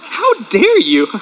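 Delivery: drawn out, high mock-indignant "how dare you"; mock offense. Pastor jokes with the congregation about the tissues, mock-indignant. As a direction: play wounded pride, hammed-up. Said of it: "a little insulted".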